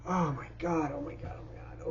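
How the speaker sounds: near-silent real whisper of despair; barely voiced, talking to himself; breathless whisper. Real, not acted. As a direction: whisper it to yourself, overwhelmed. Overwhelmed, losing his train of thought.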